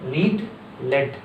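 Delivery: slow लीड, लेड, long ee then short e; contrast leed and led. Shown as a demonstration. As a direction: set लीड against लेड clearly. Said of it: "इसे लीड पढ़ रहा है".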